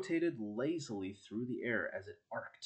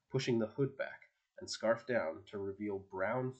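ordinary delivery, nothing marked

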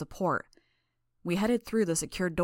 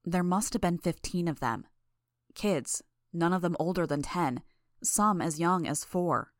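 usual speaking voice

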